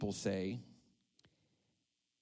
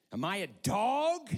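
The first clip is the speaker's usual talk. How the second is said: high, loud roar of outrage; 10 semitones higher, 9 dB louder than usual. A narrator's reading. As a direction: roar it as a challenge. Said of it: "he roared at David".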